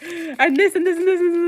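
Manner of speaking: cramming every word in, laughing; laughing imitation. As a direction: giggle through the list. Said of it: "I was just like".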